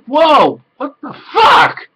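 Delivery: high, loud, slow rising what the fuck; drawn-out incredulous yelp; squeaky shocked outburst; shocked disbelief. Retold or imitated. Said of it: "And I'm like".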